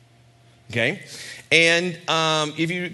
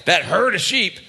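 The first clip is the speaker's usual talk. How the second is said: higher, louder sneer about the sheep; condescending brother; 7 semitones higher, 4 dB louder than usual. Retold or imitated. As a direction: talk down to the little brother. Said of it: "just hear how condescending that stuff is".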